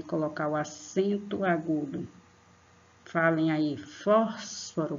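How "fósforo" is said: "Fósforo" very slow, "Fós" stretched; stress on first syllable. Shown as a demonstration. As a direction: hold "Fós", then release the rest of "Fósforo". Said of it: "vocês põem mais força".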